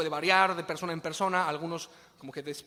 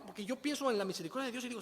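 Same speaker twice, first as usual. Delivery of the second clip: usual speaking voice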